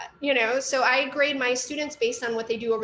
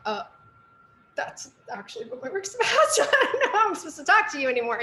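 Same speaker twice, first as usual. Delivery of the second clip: laughing; 6 semitones higher than usual. Retold or imitated. Retorting to a dismissive teacher.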